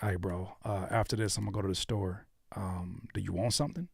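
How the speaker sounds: soft, low, offhand chat; low-key close-up conversation; casual speaking voice. Shown as a demonstration. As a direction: ask a buddy quietly, with easy ums. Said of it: "You talk like this all day long".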